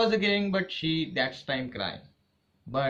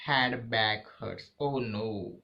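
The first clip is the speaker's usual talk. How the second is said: ordinary talk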